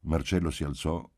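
ordinary delivery, nothing marked

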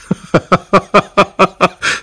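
long, high-pitched giggle; light staccato peal of laughter. A narrator's reading. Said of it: "Mia madre rise".

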